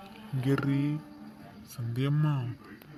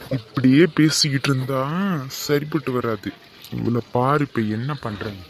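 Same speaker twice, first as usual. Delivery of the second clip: loud resolve; 11 dB louder than usual. Acted. Husband resolves to act boldly.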